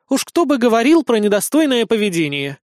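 a touch of scornful amusement; smirking jab. A narrator's reading. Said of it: "усмехнулась Иришка".

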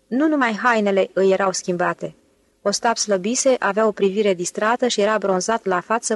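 ordinary delivery

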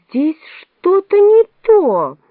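high, loud, drawn-out objection; uneasy protest. A narrator's reading. Alice begins to object to the Queen, high and loud. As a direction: raise the voice in slow, rising protest.

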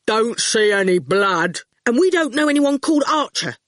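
put-on sharp, curt boy's retort; irritated retort; snapping. A narrator's reading. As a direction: snap back at the intruder. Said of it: "Howard snapped".

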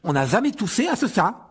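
high, indignant denial; furious retort. Acted. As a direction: protest hotly. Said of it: "le regard furieux". (protestation furieuse, haut perchée)

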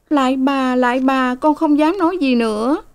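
high, imploring servant voice; bow and beg, high; begging maid. A narrator's reading. Sen bows, begging. (giọng cô hầu van lạy, cao)

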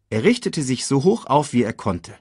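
plain reading voice

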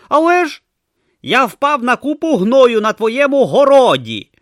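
high and loud, indignant; grumpy tortoise god. Acted. Tortoise god to Brutha about eagles dropping him, high indignant. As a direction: complain about eagles like an offended deity. Om voice held.

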